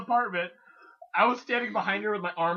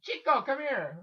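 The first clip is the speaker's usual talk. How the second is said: mother calling out; 6 semitones higher than usual. Retold or imitated. Acted mother calling her son over.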